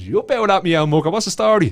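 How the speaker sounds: put-on loud, high-pitched hail to an old mate; boisterous phone greeting. Friend answering the phone from an ashamed mate, boisterous greeting. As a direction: belt out a delighted, rowdy greeting. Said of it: "he picked up the phone, he goes".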